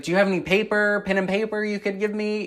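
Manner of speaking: high-pitched, falsely casual request; put-on politeness. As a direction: ask sweetly to hide your nerves. Retelling put-on casual request to him.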